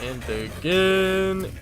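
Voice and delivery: slow, drawn-out spooky repeat; hushed eerie mimicry of the villain; mock creepy echo. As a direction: drag each again out like a ghost. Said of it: "echoing villain line".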